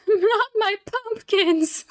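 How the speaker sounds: high, slow laughing wail; mock wail. Mock wail over pumpkins.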